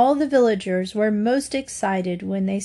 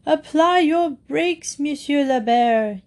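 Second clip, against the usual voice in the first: drawn-out, higher-pitched call; narrated yell; 6 semitones higher than usual. A narrator's reading. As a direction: yell a slow warning to a rider. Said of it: "yelled a man in English".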